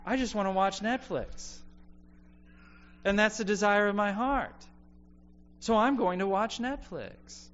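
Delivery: high-pitched parody of an indulgent excuse; self-indulgent inner voice. Acted.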